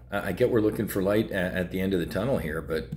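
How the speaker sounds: ordinary talk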